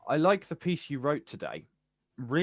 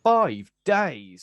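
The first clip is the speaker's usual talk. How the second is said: loud, hammered out; furious accusation; 4 semitones higher, 12 dB louder than usual. Acted. Poet accusing the silent student in class, loud and bitter. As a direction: throw the two words at her.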